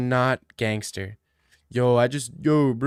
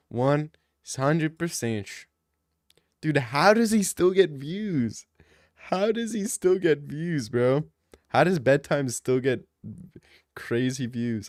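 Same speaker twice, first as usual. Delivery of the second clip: ordinary talk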